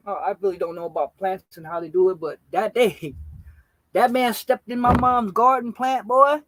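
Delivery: ordinary talk, nothing marked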